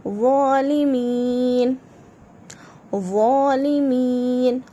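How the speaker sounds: ज़ालिमीन slow with a thick emphatic z; heavy zoe, slow. Shown as a demonstration. Teacher drilling ज़ालिमीन, slow heavy zoe. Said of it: "ये मोटा पढ़ा जाता है".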